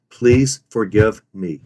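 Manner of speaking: PLEASE FORGIVE ME, slow and distinct; weight each word of "Please forgive me"; slow, plain "Please forgive me" with remorse; stressed remorse. Shown as a demonstration. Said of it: "stress each of the following three words".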